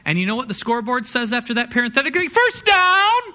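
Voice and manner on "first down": high, loud stadium call; preacher shouts the call like a stadium announcer. Pretend. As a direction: belt it like a football announcer calling a first down. Scoreboard call after the crowd's response.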